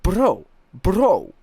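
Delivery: loud, high "bro" dropping sharply; Durka impression. Streamer showing a teammate's bro, loud high falling mimicry. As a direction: mimic a teammate, high start and steep fall.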